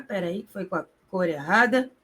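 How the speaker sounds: ordinary talk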